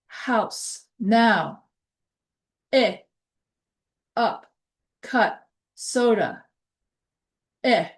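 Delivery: each word said slowly and clearly; careful demo words. Shown as a demonstration. Teacher modelling vowel sounds for the class. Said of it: "reading word list sounds".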